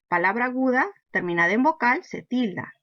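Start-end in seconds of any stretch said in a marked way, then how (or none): none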